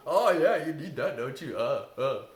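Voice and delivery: loud mocking inner critic; mocking rebel voice. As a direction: jeer like a bratty rebel. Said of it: "like the rebel".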